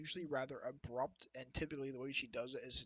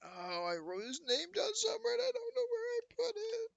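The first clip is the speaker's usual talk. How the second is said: hammed-up tearful whine, rising to a squeal; mock whining lament. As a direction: voice climbing into a very high wail. Game master laments lost note.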